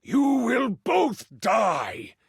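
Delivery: slow, high, roaring threat; enraged king. Acted. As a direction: draw out each word in fury. King threatens mother and son.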